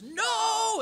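high, loud desperate scream. A narrator's reading. Husband screaming again over wife's body, desperate shout. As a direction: scream it out in grief. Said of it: "then he screams".

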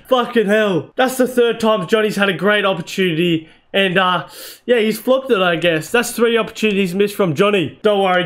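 ordinary talk, nothing marked

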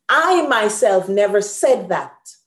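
emphatic, slow, loud; with attitude. Shown as a demonstration. Teacher shows pupils the intensive with attitude. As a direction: insist on it, I myself. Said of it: "See, with attitude".

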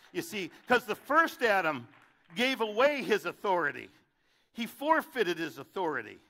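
preaching at full force. Acted. Preacher to the church, loud building preaching. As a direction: hammer the point home.

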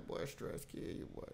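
ordinary talk

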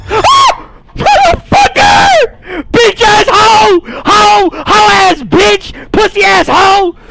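high panicked screaming curses; terrified screaming. Real, not acted. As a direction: shriek insults in pure panic. Jumpscared, screaming insults.

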